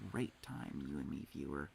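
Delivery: soft, low murmur; quiet. Real, not acted. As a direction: murmur it to the viewer. Soft intimate aside to viewer.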